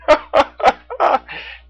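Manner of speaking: high hearty laugh; big bear laugh. A narrator's reading. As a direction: burst out laughing like a jolly bear. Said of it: "Teddy the Brown Bear laughed".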